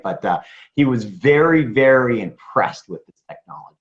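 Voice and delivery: usual speaking voice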